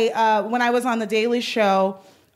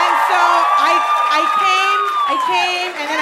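excited squeal; 10 semitones higher, 5 dB louder than usual. Retold or imitated. Overwhelmed retelling of fan joy.

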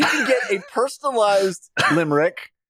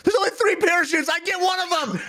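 selfish high voice; 11 semitones higher than usual. Retold or imitated. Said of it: "Dave is the guy who's like".

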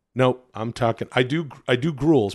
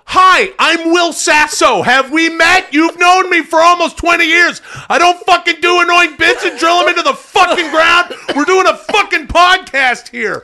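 hammed-up angry rant; 13 semitones higher, 8 dB louder than usual. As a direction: play yourself as a furious self-important star. Mocking an angry Will Sasso.

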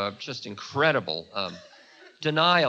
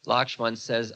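usual speaking voice

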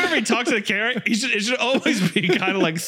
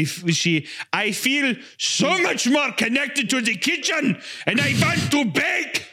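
Hitler vlogger to orator; slower than usual. Acted. Vlogger announces baking channel, building to mock dictator oratory. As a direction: start as influencer, finish as rally ranter.

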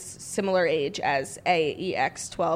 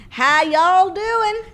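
much higher, louder, slow y'all drawl; mock Southern greeting; 10 semitones higher, 8 dB louder, much slower than usual. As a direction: ham up a cowboy howdy. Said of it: "It's me, Prince Harry".

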